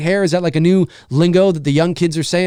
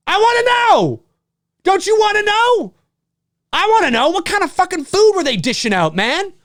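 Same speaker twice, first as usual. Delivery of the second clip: excited shout; 13 semitones higher than usual. Real, not acted. Streamer insisting on craft services food, excited shout. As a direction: demand the answer with gusto.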